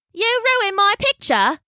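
high, slow whiny tourist; upset tourist. A narrator's reading. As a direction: complain like a tourist. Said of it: "said the tourist".

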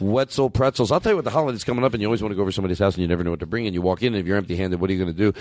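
fast, sing-along ad patter; commercial pitch. Pretend. Host slipping in a pretzel plug, commercial voice. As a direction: rattle it off like a radio spot. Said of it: "sounds like you're doing a commercial".

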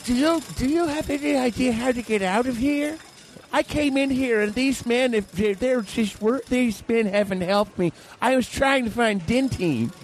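high-pitched, bewildered shopper voice; lost customer. Acted. Stranded retired customer begging a stranger for help, high lost voice. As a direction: plead like someone stranded for ages. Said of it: "another customer who's been there longer and is just lost".

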